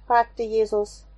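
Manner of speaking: usual speaking voice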